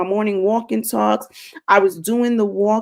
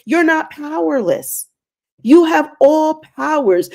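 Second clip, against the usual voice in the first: forceful; 4 semitones higher, 3 dB louder than usual. Acted. God speaking firmly to her.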